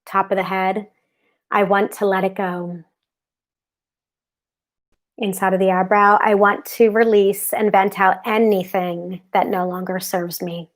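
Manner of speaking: calm, steady affirmation voice; guided tapping. Acted. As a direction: recite each tapping point soothingly. Leads tapping script aloud.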